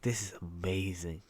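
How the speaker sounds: hushed, awed whisper. Retold or imitated. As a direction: breathe it out in awe. Quoting own awed reaction to game.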